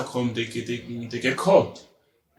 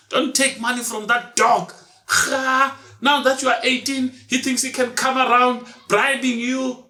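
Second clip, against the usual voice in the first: scolding mother; 10 semitones higher than usual. Retold or imitated. Mother warning daughter off stranger.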